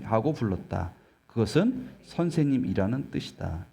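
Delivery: normal talking voice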